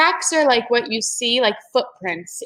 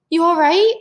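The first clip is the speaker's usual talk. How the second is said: high, louder checking voice; concerned Jack; 8 semitones higher, 6 dB louder than usual. Acted. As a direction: ask your sister if she's hurt.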